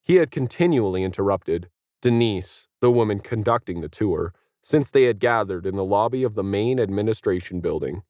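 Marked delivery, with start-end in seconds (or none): none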